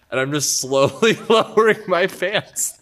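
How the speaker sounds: can't stop laughing at his own prank; laughing while telling. Real, not acted.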